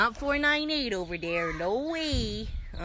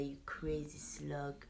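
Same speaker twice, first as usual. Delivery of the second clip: whisper; 5 semitones lower, 12 dB quieter, slower than usual. Real, not acted. Teasing a pet quietly.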